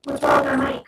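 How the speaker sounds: glitchy robotic mic distortion. Real, not acted. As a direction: voice garbled like a robot. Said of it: "a robotic voice".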